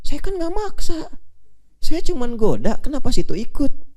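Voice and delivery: high-pitched, drawn-out mock protest; slow, high "not my fault" whine; annoying self-excusing devil. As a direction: play Satan shrugging off all the blame. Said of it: "Nyebelin banget kan?".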